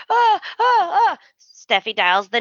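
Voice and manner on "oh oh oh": high, repeated "oh" in mock panic; panicked mock gasps. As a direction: imitate someone helplessly panicking, pitch way up. Imitates Sheila panicking wordlessly.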